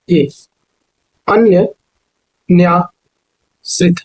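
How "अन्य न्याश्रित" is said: अन्य, then न्याश्रित, very slowly with gaps; broken into parts. Shown as a demonstration. Teacher breaking up a word for learners, अन्य and न्याश्रित said slowly apart. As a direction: split the word and stretch न्याश्रित. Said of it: "ये अनन्याश्रित होगा".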